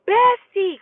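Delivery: high, loud holler to a friend; imitated calling out. Woman jumping out of the car to her friend, imitated holler. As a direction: call out urgently, pitch way up.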